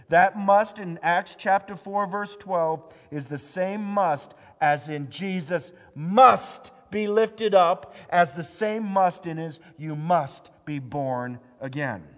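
loud, high, urgent preaching; full-force preaching. Acted. Pastor urging the church that we cannot save ourselves, preaching loud. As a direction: drive it home to the congregation.

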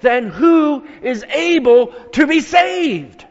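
high, loud, slowed cry of shock; panicked exclamation. Acted. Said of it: "They were so stricken and panicked with shock".

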